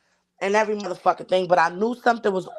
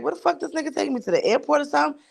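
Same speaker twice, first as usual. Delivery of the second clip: pitched up, frightened disbelief; alarmed rider questioning the driver, pitched high; 7 semitones higher, faster than usual. Retold or imitated. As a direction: sound scared of where he's taking you. Retold fear of being taken somewhere.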